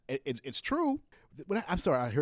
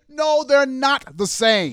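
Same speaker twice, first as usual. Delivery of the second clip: loud, slow, emphatic no; slow down and hammer it home loud; loud slow denial of both sides being equal; yelling; 7 semitones higher, 8 dB louder, much slower than usual. Real, not acted. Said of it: "I'm sorry for yelling".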